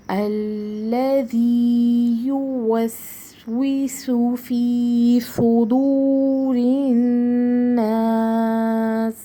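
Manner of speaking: slow, drawn-out tajweed recitation; Quranic recitation. Shown as a demonstration. Teacher recites the verse for her students, slow and chanted. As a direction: recite each word long and measured. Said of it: "ایک ایک لفظ کو ہم نے کتنا focus".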